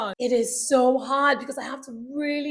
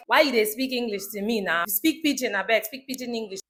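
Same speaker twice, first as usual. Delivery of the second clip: lower-pitched Nigerian Pidgin demand; 5 semitones lower, 4 dB quieter than usual. Retold or imitated. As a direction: imitate a Nigerian insisting on Pidgin. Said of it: "when I meet Nigerians".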